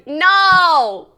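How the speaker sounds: loud, high, drawn-out no; emphatic refusal. Real, not acted. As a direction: bellow a long no. Rejecting the idea loudly.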